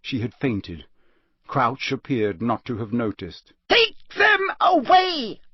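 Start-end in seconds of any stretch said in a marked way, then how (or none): 3.7-5.4 high slow roar; enraged judge roaring. Acted. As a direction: roar the order at the guards. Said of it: "Crouch roared".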